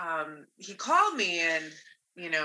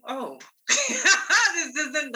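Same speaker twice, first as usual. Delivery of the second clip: laughing realization; 11 semitones higher, 8 dB louder, much slower than usual. Retold or imitated. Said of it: "I'm like".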